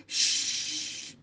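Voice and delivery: long hushing shh. Acted. Boy mimes being told to hush, long shh. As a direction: shush them with a long hiss. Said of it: "facendo il segno del dito indice".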